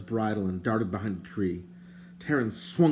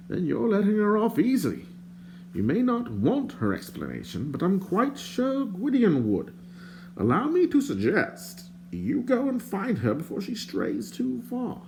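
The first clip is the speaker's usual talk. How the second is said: higher, prim and indignant stranger; lightly voiced fussy stranger; 11 semitones higher than usual. A narrator's reading. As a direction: play the fussy bard, put out. The bard's voice.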